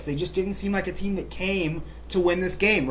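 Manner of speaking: ordinary delivery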